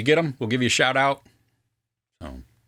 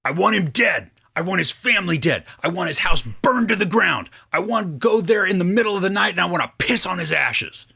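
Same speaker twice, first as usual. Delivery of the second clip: aggressive movie quote; 6 semitones higher than usual. Acted. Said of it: "I didn't do the right accent ... be aggressive like that".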